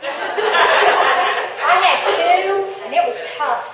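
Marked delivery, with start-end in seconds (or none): 0.0-2.5 laughing. Real, not acted. Laughs about older brothers.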